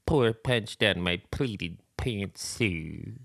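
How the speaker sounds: very slow, droopy cartoon voice; put-on sluggish hangdog impression. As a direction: drag it out like a mournful cartoon hound. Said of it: "real good one for Droopy".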